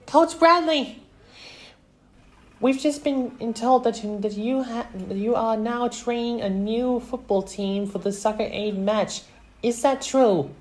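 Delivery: very high, loud questioning voice; eager reporter. A narrator's reading. Reporter questioning the coach, high and eager.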